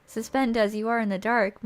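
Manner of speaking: normal talking voice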